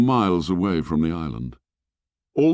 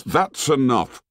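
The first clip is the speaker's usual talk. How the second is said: slow, raised-pitch cut-off; sharp command; 7 semitones higher than usual. A narrator's reading. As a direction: cut him short sharply. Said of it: "said Ralph sharply".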